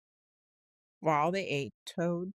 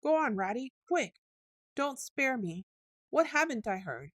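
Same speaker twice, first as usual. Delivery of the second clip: higher, urgent pleading; anxious; 7 semitones higher, 4 dB louder than usual. A narrator's reading. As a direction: beg him to tell quickly. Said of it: "turning rather pale".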